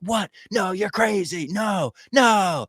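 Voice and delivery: imitated high-pitched, pleading denial; hammed-up desperate protest. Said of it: "he is desperate. He's begging. He's screaming".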